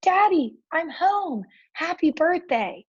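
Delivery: high-pitched, excited calling out; excited yelling. A narrator's reading. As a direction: call out the big homecoming in a high voice.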